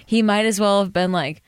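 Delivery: ordinary talk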